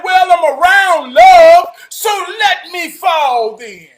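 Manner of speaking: loud, high, happy answer; joyful reply. Retold or imitated. Trav answering friends, loud and joyful. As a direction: shout it back with joy. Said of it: "I say".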